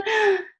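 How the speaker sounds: sharp gasp. Acted. Shocked reaction to the wolf.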